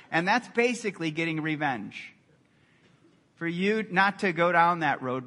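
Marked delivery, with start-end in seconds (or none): none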